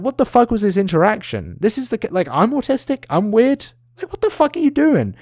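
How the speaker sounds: high, disbelieving outburst; incredulous. Real, not acted. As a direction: voice climbing in disbelief at the interaction. Incredulous rant, pitch jumps.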